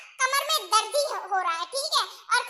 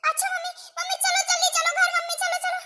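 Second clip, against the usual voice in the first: worried urging; 7 semitones higher than usual. Acted. Daughter hurrying injured mother home.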